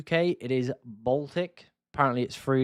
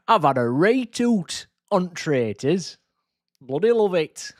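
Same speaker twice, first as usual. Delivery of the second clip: put-on slow, higher, drawn-out Yorkshire accent; imitating thick Yorkshire accent; 4 semitones higher, 4 dB louder than usual. Taking the mick out of a Yorkshire Traitors contestant, thick Yorkshire accent. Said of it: "had a proper thick Yorkshire accent".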